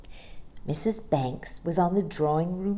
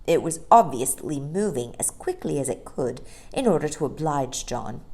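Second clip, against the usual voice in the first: plain reading voice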